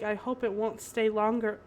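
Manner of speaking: ordinary talk, nothing marked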